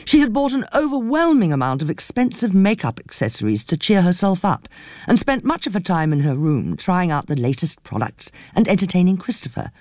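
usual speaking voice

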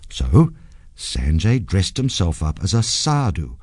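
usual speaking voice

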